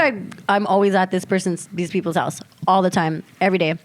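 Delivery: ordinary talk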